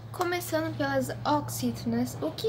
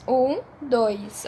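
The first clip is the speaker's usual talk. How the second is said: normal talking voice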